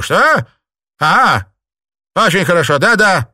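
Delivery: high, loud sudden reply; startled acceptance. A narrator's reading. Mistress offered brandy, startled. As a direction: snap out then accept.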